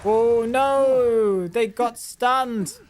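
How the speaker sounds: usual speaking voice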